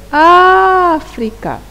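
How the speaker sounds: "África" called out slowly, Á held long, high and loud; stretched stress on "África". Shown as a demonstration. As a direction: call "África" with a long, loud first syllable. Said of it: "pronunciamos com maior intensidade".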